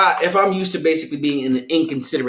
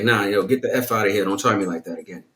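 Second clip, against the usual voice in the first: serious warning; 7 semitones lower, 4 dB quieter than usual. Shown as a demonstration. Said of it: "my tone".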